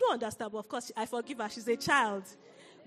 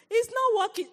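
put-on frustrated child; 9 semitones higher, 6 dB louder than usual. Frustrated daughter fixing toy.

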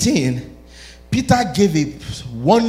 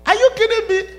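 much higher mock disbelief; incredulous. Real, not acted. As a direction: act stunned at the twist. Preacher reacts to Satan rebuke.

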